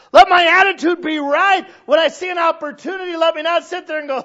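loud, high, slow fervent preaching; preaching at full force. Acted. Pastor urging his church, fervent shouted preaching. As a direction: pour it out like a prayer.

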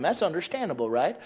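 ordinary delivery

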